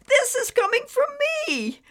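put-on very high, loud delighted childlike shout; joyful yell. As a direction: yell for pure joy. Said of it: "he yelled with joy".

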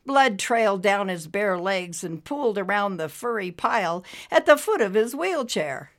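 ordinary delivery

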